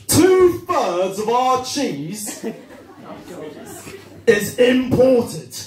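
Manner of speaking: mock political speech, slow and high; hammed-up, halting orator; Liz Truss. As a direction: declaim it with big pauses and overblown weight. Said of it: "here's a quote from Liz Truss".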